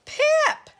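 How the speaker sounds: very high, loud squeak; squeaked cry. A narrator's reading. Squeak crying out as Pip jumps, squeaked cry. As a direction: squeal the name in alarm. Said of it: "squeaked Squeak".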